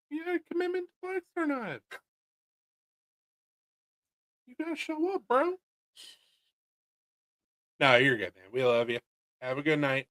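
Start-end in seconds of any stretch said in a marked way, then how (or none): 0.1-5.6 very high, squeaky mock scolding; mock pleading. Teasing Brian about his commitment as he leaves, squeaky mock pleading. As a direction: pitch it way up, playfully guilt-tripping.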